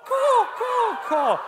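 very high, loud cawing crow voice. Shown as a demonstration. Youth pastor leading kids in a cawing crow call. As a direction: caw the word call like a crow. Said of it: "wanted to sound like a crow".

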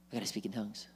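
softly fretting to himself; a worried seeker murmuring under his breath; anxious. Acted.

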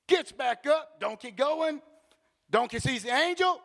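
ordinary talk, nothing marked

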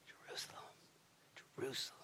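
breathy whisper of grief over the city; whispered lament. Acted. Jesus weeping over Jerusalem, in a whisper. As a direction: breathe the name out in sorrow. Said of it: "He'll weep over Jerusalem".

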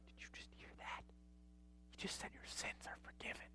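hushed, breathless whisper. Acted. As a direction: whisper it like a secret in shock. Scribes exchanging shocked looks.

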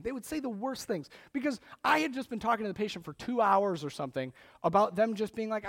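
ordinary talk

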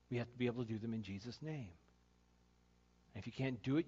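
normal talking voice

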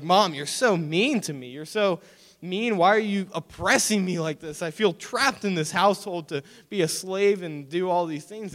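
high-pitched mock complaint to mom; hammed-up self-pitying middle schooler; whining kid. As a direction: plead like an oppressed, put-upon child. Acts out his whining younger self.

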